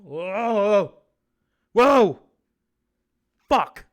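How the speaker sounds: loud gasping whoa, snapping back; jolted out of a trance; startled exclamations. Acted. Host snapping out of the seance, loud startled whoas.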